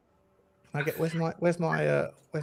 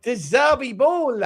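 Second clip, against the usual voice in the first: put-on Italian accent; 5 semitones higher, 3 dB louder, slower than usual. Still in the impression.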